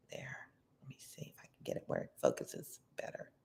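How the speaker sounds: soft whisper to herself; whispered aside. Real, not acted. Reader fiddles with the picture, whispering aside. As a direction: mutter it under the breath.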